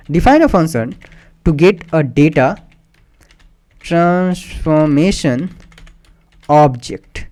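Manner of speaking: very slow, broken into separate chunks; typing-along dictation. Real, not acted. Teacher typing a function's name for students, slowly dictating. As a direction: pace each word to slow typing.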